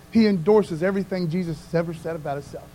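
ordinary talk

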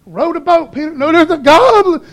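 high, loud cry of alarm; mock panic. Disciple telling Peter to row from a goblin, panicking. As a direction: yell a hammed-up warning to the rower.

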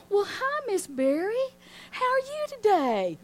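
high, syrupy mock-friendly voice; imitating the gruff guard turned sugary nice; sugary sweet greeting. Mean office guard greeting her in a sweet high voice.

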